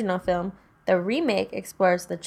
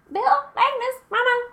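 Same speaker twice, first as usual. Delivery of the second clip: very high, eerie put-on female voice; creepy woman voice. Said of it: "the creepy woman voice".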